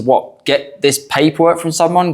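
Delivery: ordinary talk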